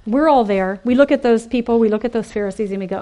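ordinary talk, nothing marked

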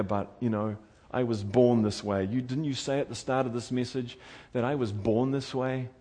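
ordinary delivery

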